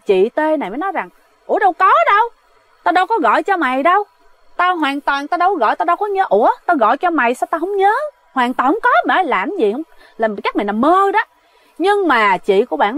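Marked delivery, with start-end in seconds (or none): 1.5-11.2 high, fast, baffled protest; incredulous denial. Retold or imitated. Friend denies the midnight call, high baffled protest. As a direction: insist you never called, taken aback.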